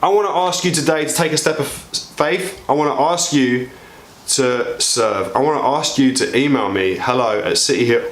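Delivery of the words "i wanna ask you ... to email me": ordinary talk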